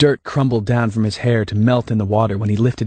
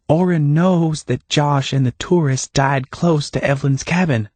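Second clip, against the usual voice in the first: higher, lightly voiced Hannah; Hannah, calm and knowing; 6 semitones higher than usual.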